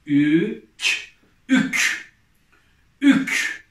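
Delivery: loud, drawn-out syllable reading for the class; reading syllables aloud. Shown as a demonstration. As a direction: sound out each letter clearly, then blend them loudly. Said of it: "Okuyalım".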